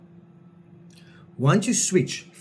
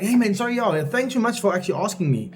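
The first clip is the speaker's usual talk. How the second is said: much higher, upbeat and eager; high energy; 7 semitones higher than usual. Shown as a demonstration. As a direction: bounce right back, cheerful and keen. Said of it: "high energy".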